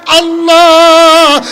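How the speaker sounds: long, held chanted cry. Retold or imitated. Adam cries to God with eyes closed, crying out. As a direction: call out the name and hold it. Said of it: "চিৎকার দিয়ে বলে".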